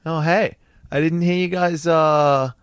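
put-on high, loud, friendly Canadian accent. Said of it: "In this really nice, humbled Canadian voice".

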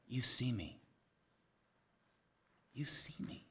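very soft, with long pauses; hushed wonder. Acted. Hagar recognizing that God sees her, hushed wonder. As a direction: let the realization land quietly.

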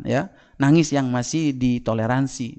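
ordinary talk

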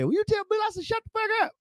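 cackling advice from a comedy legend; Richard Pryor. Retold or imitated. Pryor on the phone advising the young comic, high gleeful voice. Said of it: "Richard Pryor gave me some virtue".

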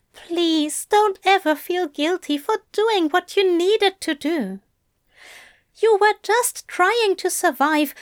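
tender Crowley talking the angel out of guilt; Crowley reassuring. A narrator's reading. Crowley's voice in narration.

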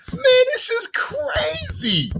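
high, drawn-out excited cry; real excitement, voice shooting way up; hyped-up. Real, not acted. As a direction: stretch it out in amazed disbelief. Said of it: "You hear, you hear the hypeness in my voice?".

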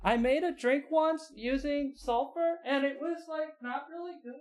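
high, fading rambling voice; high squeaky robot voice. Acted. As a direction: squeak it, trailing to a hush. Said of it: "and he's like".

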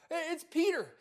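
high, loud, thrilled outburst; so excited. Retold or imitated. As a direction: act out bursting with good news. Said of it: "she was so excited".

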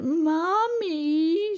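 long high loud wail; wailing scream. A narrator's reading. Cousin calls her mother to get a boy in trouble, loud wail. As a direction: wail for mother at full pitch.

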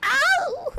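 high, sudden "ow"; sympathetic yelp. Real, not acted. Hearing about broken ankle, sympathetic yelp. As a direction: wince aloud with a high cry.

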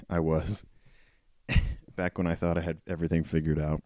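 usual speaking voice

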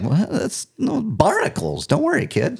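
high, lightly voiced mom calming her kid; mom reassuring. Mom telling her scared kid not to worry, light reassuring voice. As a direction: play the mom brushing off the fear. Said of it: "my mom was like".

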